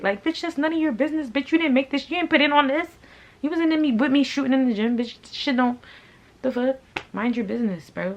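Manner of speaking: high, loud, heated ranting; angry outburst. Real, not acted. Business owner telling off a nosy former friend, furious. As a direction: let the outrage spill out at high pitch.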